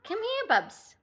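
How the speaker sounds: high, coaxing pet voice; calling the cat. Real, not acted. Pet sitter coaxing the cat to greet viewers, sing-song.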